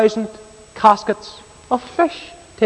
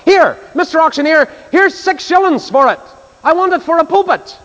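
high, excited calling out; eager cry; 10 semitones higher than usual. Retold or imitated. Billy bids to the auctioneer for a pulpit, eager cry. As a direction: hail someone across a busy room. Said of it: "he cried out".

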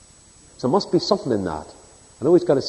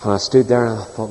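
ordinary talk, nothing marked

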